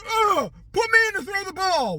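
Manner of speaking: hammed-up yelling wife; sassy shout. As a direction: shriek it like a furious pop star. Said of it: "She's like".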